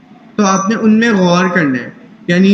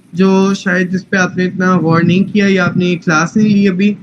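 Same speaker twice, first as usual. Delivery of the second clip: normal talking voice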